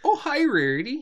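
high-pitched Minnesotan parent; Minnesotan accent. Acted. Rarity's parent greeting her, high Minnesotan accent. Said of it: "They're very Minnesotan".

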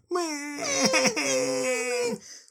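high cartoon laugh; hammed-up high trickster laugh; high cackle. Discord's cackle.

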